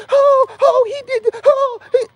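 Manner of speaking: very high, loud falsetto mimicry of a fussing woman; mocking high voice. Mocking a fussing woman in a very high squealing falsetto. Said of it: "then say".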